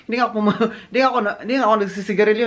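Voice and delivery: fast words tumbling out through laughter; laughing while talking. Real, not acted. Vlogger laughing to her viewers about the cigarette request, fast laughing talk. Laughing retelling being asked.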